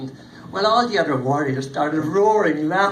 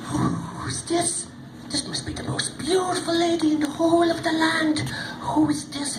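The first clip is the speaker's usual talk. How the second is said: awed; 10 semitones higher than usual. Acted. Warriors awed by lady.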